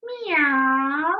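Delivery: long drawn-out meow; cat meow. Acted. Teacher giving children a clue to the first animal, cat meow. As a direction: imitate a cat's meow. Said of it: "The first animal says".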